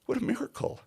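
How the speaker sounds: hushed, then soaring high on miracle; breathless wonder at the sum; awed whisper. Retold or imitated. Awed when the total nearly closes, whispered then high. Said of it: "I'm like".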